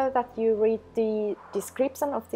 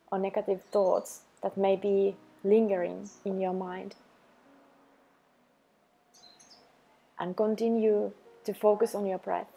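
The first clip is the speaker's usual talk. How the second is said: calm, even meditation voice with long pauses; unhurried, level delivery for a self-love practice; guided meditation. Acted. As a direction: guide the listener gently into the breath, leaving space after each phrase.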